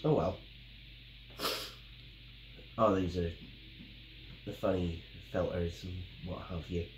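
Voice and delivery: normal talking voice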